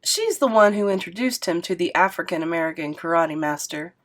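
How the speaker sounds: ordinary delivery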